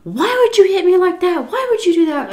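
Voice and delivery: high strained yell at her; retold screaming. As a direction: shout it up high, outraged. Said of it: "And he was just screaming".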